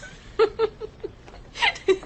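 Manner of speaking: high, bright teasing giggle; playful burst of laughter. Acted. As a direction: giggle at her own joke. Laughing at her own tease.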